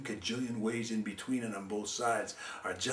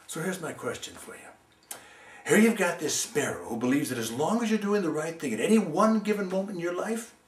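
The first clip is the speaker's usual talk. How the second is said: plain reading voice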